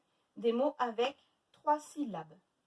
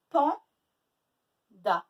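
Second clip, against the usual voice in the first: PANDA as loud PAN, long pause, da; split into syllables; 2 semitones lower, 8 dB louder than usual. Shown as a demonstration. Teacher working the example with pupils, syllable by syllable. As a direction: break Panda into two well-separated beats. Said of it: "deux syllabes".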